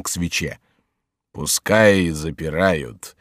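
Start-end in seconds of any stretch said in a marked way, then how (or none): 1.3-3.2 slow, low drawn-out repeat; lazy, low dismissal; unconcerned monk. Acted. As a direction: sink lower and stretch it out. Monk repeats it, admiring wine.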